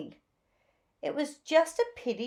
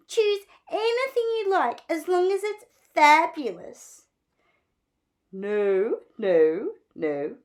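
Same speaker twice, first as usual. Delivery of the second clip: gushing Zelda; 8 dB louder than usual. A narrator's reading. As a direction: gush over the new look like a flamboyant stylist. Zelda voiced.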